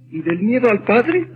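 ordinary talk, nothing marked

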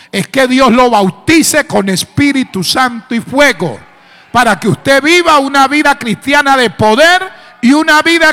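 loud, high preaching climax; preaching at full force. Acted.

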